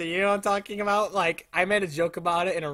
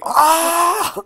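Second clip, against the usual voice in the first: man's scream; 9 semitones higher, 7 dB louder than usual. Retold or imitated. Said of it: "a guy going".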